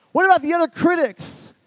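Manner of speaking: high, loud burst; alarmed outcry. Retold or imitated. All these voices pressing on Moses, alarmed outcry. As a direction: fire the worried question out loudly.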